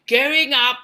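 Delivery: snooty British recorded announcement; condescending British elevator voice. Retold or imitated. Elevator announcing to cruise passengers, condescending British accent. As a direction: Downton Abbey British, talking down to passengers. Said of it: "It was a condescending ... voice ever".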